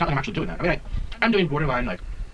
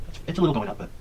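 usual speaking voice

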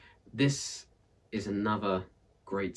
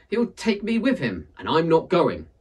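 much higher, louder, insistent; stubborn refusal; 8 semitones higher, 8 dB louder than usual. Acted. Aunt Misery refusing to go with Death, higher stubborn. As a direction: refuse flatly, pitched high.

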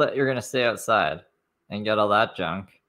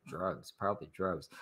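muttered aside; 13 dB quieter than usual. Retold or imitated. Answering her own mock question, a soft sly aside. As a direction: toss off the punchline under your breath.

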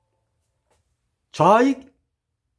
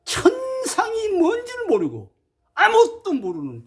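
high, breaking cry of grief; 10 semitones higher than usual. Real, not acted. Preacher lamenting to the congregation, near tears. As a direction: let the voice crack with sorrow. Grieving over regional division.

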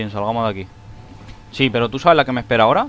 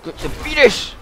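high loud repeated screams; frantic high-pitched screaming fit; panicked shrieking; 5 dB louder than usual. Real, not acted. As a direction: shriek at the zombies to get lost. Shrieking at enemies to go away.

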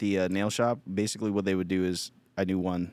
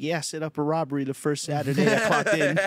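boastful mock voice; 8 semitones higher, 4 dB louder than usual. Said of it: "be like".

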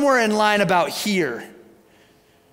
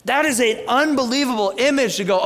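ordinary talk

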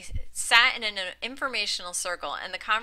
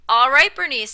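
louder, brisk nudge; quick prompt; 5 semitones higher, 12 dB louder than usual. A narrator's reading. Warren at the barbershop curb, brisk loud prompt. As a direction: hurry her along. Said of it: "said Warren quickly".

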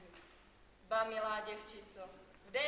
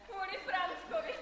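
mocking laugh; 8 semitones higher, 5 dB louder than usual. Aunt mocks the girl's suitor.

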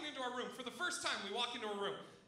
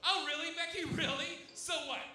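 high-pitched sarcasm building louder; imitated sarcastic girl; 6 semitones higher, 5 dB louder than usual. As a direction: scoff as a girl, growing louder and more incredulous. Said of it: "you hear one girl just go".

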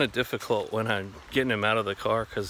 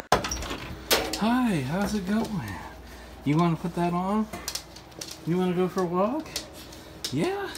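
high cheerful voice for the dog; talking to a dog; 8 semitones higher, slower than usual. Real, not acted. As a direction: pitch up to excite the dog. Excites dog about a walk.

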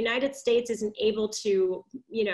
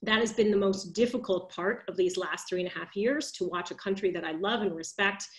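ordinary talk

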